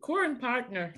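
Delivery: amused Irish-accent mimicry; imitated Irish accent. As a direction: parrot the phrase in mock Irish. Echoing co-host's Irish accent.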